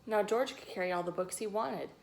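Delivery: usual speaking voice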